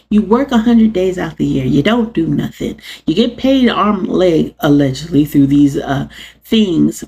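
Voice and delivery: ordinary talk, nothing marked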